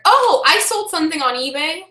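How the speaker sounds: high, put-on chirpy imitation; awkward acquaintance. Someone hears you're a reseller, mock-eager reply. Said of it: "like, the awkward".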